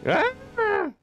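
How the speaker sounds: sudden high pitched scream. Real, not acted. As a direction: shriek in sudden fright. Scream at scare.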